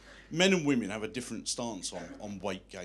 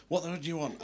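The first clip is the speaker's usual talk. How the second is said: higher-pitched demand without the shout; mildly exasperated retake; 6 semitones higher than usual. Retold or imitated. Comedian redoing the stewardess's line, mildly exasperated. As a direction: replay her irritation at a contained volume.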